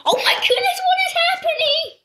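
high, loud laughing disbelief; laughing squeal. Real, not acted. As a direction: squeal through laughter at the chaos. Laughing surprise at chaos.

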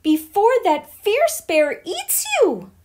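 high, loud warning shout; fearful cry. A narrator's reading. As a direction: yell it in alarm. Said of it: "the witchling cried in fear".

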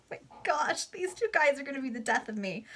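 high, laughing; real amused aside. Real, not acted. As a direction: say it recovering from laughter.

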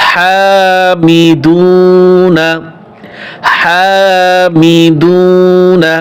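হামিদুনা chanted very slowly, vowels held long; long drawn recitation. Shown as a demonstration. Teacher models হামিদুনা for students, slow and drawn out. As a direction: stretch out হামিদুনা for the class. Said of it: "এগুলো এক আলিফ টান হবে".

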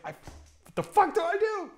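ordinary talk, nothing marked

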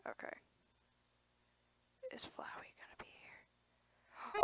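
nervous hushed whisper; a scared little whisper at the screen. Real, not acted. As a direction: breathe it out, barely voiced. Nervous whisper before a character appears.

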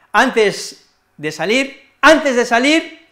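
"Antes" punched high, whole phrase slowed; exaggerated stress on antes. Shown as a demonstration. Teacher shows learners which word carries weight, heavily stressing "antes". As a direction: hit "antes" hard and stretch it out. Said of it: "Esa palabra antes la enfatizamos".

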